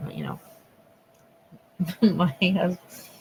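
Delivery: normal talking voice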